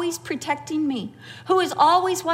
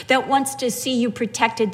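ordinary delivery

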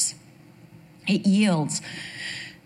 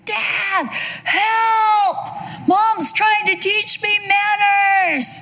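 high, drawn-out wail for help; hammed-up child's scream, very high-pitched; 14 semitones higher, 3 dB louder, much slower than usual. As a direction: yell for Dad like a horrified kid. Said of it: "he screamed out".

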